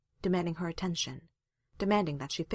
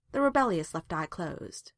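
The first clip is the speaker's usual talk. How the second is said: ordinary delivery, nothing marked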